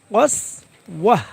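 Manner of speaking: slow কসওয়াহ, falling onto a final h; drawn-out pausal কসওয়াহ; waqf ending in h. Shown as a demonstration. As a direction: close কসওয়াহ on a breathed h, no "-tan". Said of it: "সাকিন হয়ে হা হয়ে যাবে".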